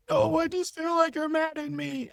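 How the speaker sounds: high-pitched imitation of girlfriend; mock girlfriend complaint. Mimics girlfriend complaining on call.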